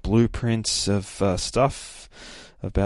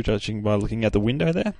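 ordinary delivery

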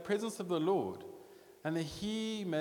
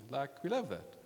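ordinary talk, nothing marked

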